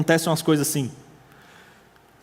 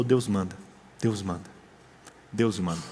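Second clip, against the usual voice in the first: quiet faith; 9 semitones lower, 7 dB quieter than usual. Retold or imitated. Pastor answers how he lives.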